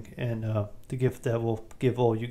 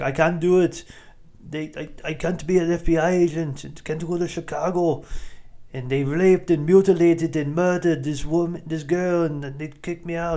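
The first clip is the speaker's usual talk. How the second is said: higher, pleading mock Arnold refusal; imitating Arnold; 6 semitones higher than usual. Arnold refusing his old buddy, a lightly higher mock plea. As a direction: play Arnold turning the job down, a bit higher. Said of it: "And Schwarzenegger's like".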